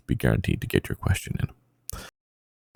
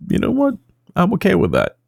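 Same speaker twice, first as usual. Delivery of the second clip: mock dramatic high squeak; 13 semitones higher, faster than usual.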